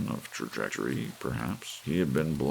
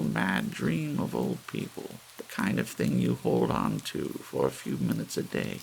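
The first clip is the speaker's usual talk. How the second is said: put-on higher old woman's voice, unhurried, dreamy; wistful old lady admitting their folly; gentle old lady; 6 semitones higher than usual. A narrator's reading. Missus Bellows explains old people's dream.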